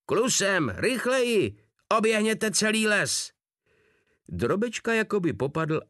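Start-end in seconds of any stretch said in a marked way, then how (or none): 0.1-3.3 howled orders. A narrator's reading. Captain hysterically yelling at the running soldier. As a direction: keep howling faster commands.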